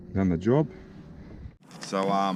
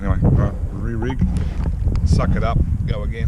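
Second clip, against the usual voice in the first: louder, pushing himself on; loud self pep talk; 8 dB louder than usual. Real, not acted. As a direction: shout it to reset. Pumps himself up after loss.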